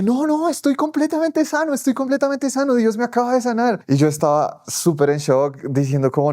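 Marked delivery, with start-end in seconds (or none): 0.0-3.8 put-on very high, jubilant cry of a healed boy; joyful healed boy. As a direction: shout it like you just got healed.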